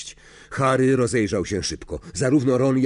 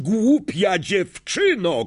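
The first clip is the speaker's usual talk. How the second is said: high-pitched angry cry, drawn out; krzyk; 10 semitones higher, slower than usual. A narrator's reading. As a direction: cry it out slowly in outrage. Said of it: "krzyknął Snape". (gniewny, przeciągły krzyk)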